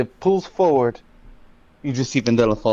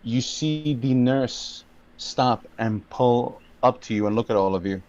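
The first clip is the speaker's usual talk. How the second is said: ordinary delivery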